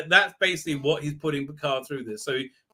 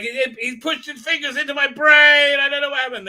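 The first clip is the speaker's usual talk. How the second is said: high, loud mock panic; panicked; 11 semitones higher, 7 dB louder than usual. The scared boy tells of the Vulcan mind meld, panicked shout. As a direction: blurt it like a scared kid.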